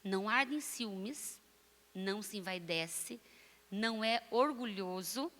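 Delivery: deliberate reading, pausing after each phrase; measured, spaced-out scripture reading. Shown as a demonstration. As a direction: read each phrase slowly and let it land. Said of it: "Vou ler mais devagar".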